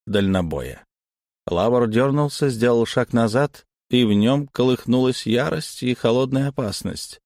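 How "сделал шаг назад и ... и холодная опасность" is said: ordinary delivery, nothing marked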